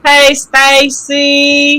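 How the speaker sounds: slow, stretched hello; sing-song slow hey; drawn-out greeting. Acted.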